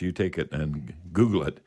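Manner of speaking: usual speaking voice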